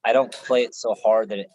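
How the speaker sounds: ordinary talk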